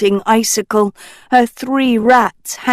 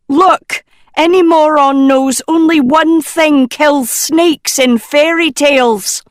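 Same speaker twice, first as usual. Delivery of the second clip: higher, loud exasperated outburst; exploding; 8 semitones higher, 5 dB louder than usual. Acted. Nicola losing patience with the crew, exploding. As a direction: burst out, fed up with them all. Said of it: "Nicola exploded".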